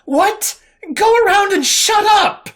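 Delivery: high, loud, furious bellow; furious shout at a stranger in line. A narrator's reading. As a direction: bellow at the stranger to get lost. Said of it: "she bellowed".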